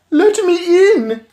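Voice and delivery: put-on high, loud whiny boy; impatient spoiled boy; bratty boy. A narrator's reading. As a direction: demand it like a spoiled kid. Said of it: "came the boy's voice".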